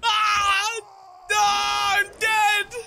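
high shouted death cry; frantic shout. Real, not acted. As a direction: scream as the monster kills you. Killed by Bigfoot.

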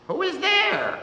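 put-on high, startled old woman calling through the window; a sick widow, scared by a knock at dawn; frightened high call from the bed. A narrator's reading. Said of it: "asks a frightened voice".

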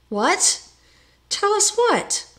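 high, loud, urgent question; eager. A narrator's reading. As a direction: carpenter desperate to be told. Carpenter eager to hear visions.

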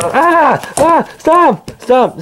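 high yelps telling them to stop; sharp, high-pitched protest; protesting cries. Acted. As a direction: cry out mid-scuffle. Scuffle over the flashlight.

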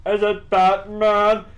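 high, loud squeaky questioner; other party's voice. Acted. Player answering his own knock-knock, squeaky voice. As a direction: put on a high, timid-silly voice.

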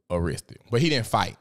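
ordinary talk, nothing marked

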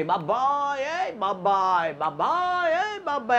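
high, drawn-out call to the neighbour at night; wife calling out. Retold or imitated. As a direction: call the neighbour's name up high and long, again and again. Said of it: "અને રાડ પાડી".

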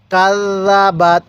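কাল্লাবাত with the ল্ল held long; doubled l demo. Shown as a demonstration. Teacher models কাল্লাবাত with a held double l. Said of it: "তাহলে".

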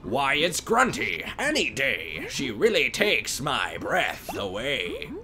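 witch Gruntilda. Acted. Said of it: "And there she is now!".

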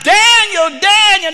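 high, loud calling out a name; king calling into the lions' den, hollering. Acted. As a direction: call out across the den. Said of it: "hollered out".

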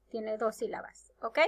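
ordinary talk, nothing marked

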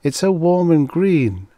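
high, happy lilt. A narrator's reading. She enthuses to her companion about Earth, happy. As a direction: sing out your delight in a high voice. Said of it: "she said happily".

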